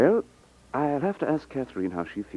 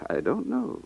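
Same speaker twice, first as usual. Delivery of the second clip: nervous laugh; 13 semitones higher, 7 dB quieter, slower than usual. Acted. Customer hesitates over buying.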